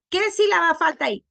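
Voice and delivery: normal talking voice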